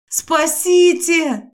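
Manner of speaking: high, loud, drawn-out scream; screaming for help. Acted. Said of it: "закричала я".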